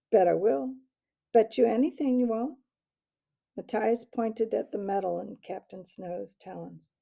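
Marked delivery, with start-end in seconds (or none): 0.1-2.6 loud, rising taunt; insistent taunt. Acted. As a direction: push back louder each time. Taunting bet exchange.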